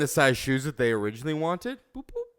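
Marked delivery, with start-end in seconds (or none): 1.9-2.4 1 radio beep imitation.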